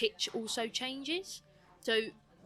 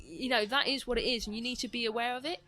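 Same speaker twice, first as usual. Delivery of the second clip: usual speaking voice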